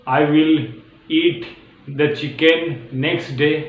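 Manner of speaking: very slow with pauses between words; slow demo. Shown as a demonstration. Teacher showing the next day version slowly. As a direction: set each word apart slowly. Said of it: "उसके जगह next डे add कर सकते हो".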